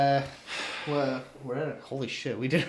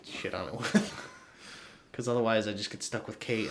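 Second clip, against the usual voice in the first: ordinary talk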